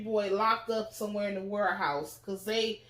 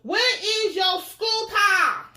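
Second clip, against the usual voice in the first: drawn-out, high, loud singsong holler; mock yell; 10 semitones higher, 8 dB louder, much slower than usual. Narrator copies the clip just played, mock yelling. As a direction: bellow it slowly, imitating the clip.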